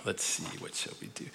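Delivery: unvoiced whisper to himself; whispered aside. Real, not acted. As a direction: whisper it under your breath. Preacher mutters to himself.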